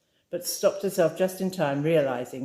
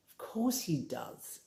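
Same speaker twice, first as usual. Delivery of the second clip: spat; 9 dB quieter, slower than usual. A narrator's reading. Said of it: "Oka spat".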